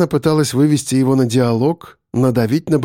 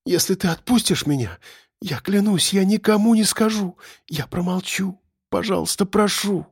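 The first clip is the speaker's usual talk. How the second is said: high, soft, fearful plea; pleading; 6 semitones higher, 4 dB quieter than usual. A narrator's reading. Captive promises silence for release, pleading. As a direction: beg in a small high voice.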